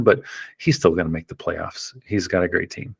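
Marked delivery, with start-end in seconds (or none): none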